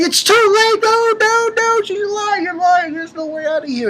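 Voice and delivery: high, wailing mock panic; hammed-up panicked denial; freaking out. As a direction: imitate her hysterics, very high and easing at the end. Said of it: "she's freaking out and freaking out".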